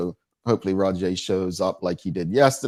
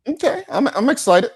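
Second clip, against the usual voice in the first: excited; 7 dB louder than usual. Real, not acted. Host gushing about the show.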